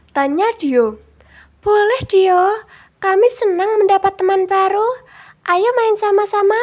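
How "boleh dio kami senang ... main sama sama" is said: high, small, cheerful animal friends; sweet high storybook critters; little animal voice. A narrator's reading. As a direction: voice the butterfly and rabbit brightly and high. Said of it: "jawab kupu-kupu dan kelinci".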